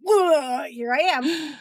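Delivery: high comic entrance; Kramer's big entrance. Acted. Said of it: "Like Kramer coming into Jerry Seinfeld's apartment".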